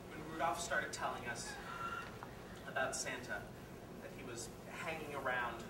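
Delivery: low, subdued, halting account; grave confession. Acted. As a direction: confess it low, with heavy pauses. Father recounts son's abuse, grimly.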